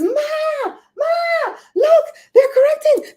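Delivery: put-on very high, loud kid calling mother; imitated scream. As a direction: shriek it like an excited daughter. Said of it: "she screamed".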